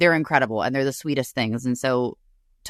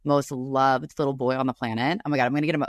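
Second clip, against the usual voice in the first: ordinary delivery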